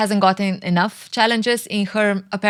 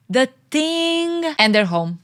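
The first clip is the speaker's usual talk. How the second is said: ordinary delivery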